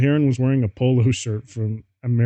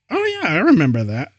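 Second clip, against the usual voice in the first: trying to be gothic; 6 semitones higher, 4 dB louder, faster than usual. A narrator's reading. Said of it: "trying to be all gothic".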